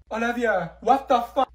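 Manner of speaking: loud, high what the fuck; shocked friend yelling at her; outraged yell. Acted. Outraged at dead dog.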